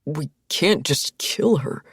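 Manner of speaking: slow, strained plea; reluctant, strained protest. A narrator's reading. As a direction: force the words out under strain. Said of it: "voice strained".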